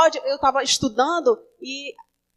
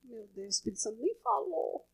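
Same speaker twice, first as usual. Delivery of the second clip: muttered aside; 4 semitones lower, 13 dB quieter than usual. Retold or imitated.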